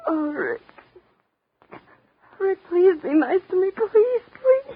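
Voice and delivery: very high, loud, crying plea; desperate weeping please; tearful pleading. Acted. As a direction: beg through sobs, climbing. Begs Rick through tears.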